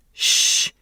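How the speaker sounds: sharp whispered shush. A narrator's reading. Turtle silencing the room, whispered shush. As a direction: hush them with a hiss of air. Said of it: "That was Turtle".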